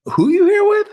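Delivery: very high, loud put-on question; exaggerated squeal of a question; acted nosy greeting. Acted greeting of someone at event.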